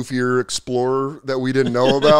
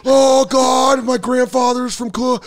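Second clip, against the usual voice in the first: mock Irish American; 9 semitones higher, 3 dB louder, slower than usual.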